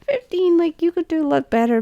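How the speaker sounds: a hint of high-voiced disbelief; unimpressed critics. Everyone judging her subscriber count, mildly mocked disbelief. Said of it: "everyone's like".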